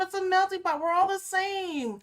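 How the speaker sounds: mock melting-pot claim. Mocking what everybody says about America. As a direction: parody the naive optimist. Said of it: "everybody's like".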